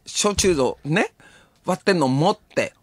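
normal talking voice

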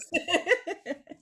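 light amused laugh; a quick real chuckle; brief giggle after the joke; short laugh. Real, not acted. After her own joke.

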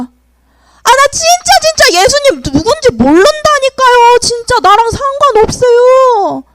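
high, loud frustrated protest; exasperated denial. Acted. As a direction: insist hotly that you don't know him. Peter's third denial.

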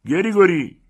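very high, loud shout down the street; summoning yell; calling out. Acted. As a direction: call the coachman from afar. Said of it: "رو به سمت کوچه بانگ زد".

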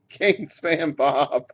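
real laughter breaking into the words; can't keep straight face. Real, not acted. Card breaker announces a hit to buyer, laughing. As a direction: laugh helplessly, then get the name out slowly. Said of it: "can't even say it with a straight face".

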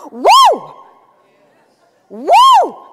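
very high, loud woo-hoo cheer; joyful whoop. Pretend. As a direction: whoop like you found the ring. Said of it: "Be like".

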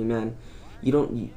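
usual speaking voice

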